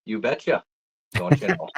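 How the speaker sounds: playful put-on Minnesota accent. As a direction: answer in homespun Minnesota speech. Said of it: "makes your Minnesota accent super evident".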